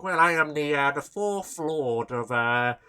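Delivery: hammed-up aristocratic British drawl; mock British aristocrat. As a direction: drop the jaw and play a pompous aristocrat. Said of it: "who's, like, a part of the aristocracy".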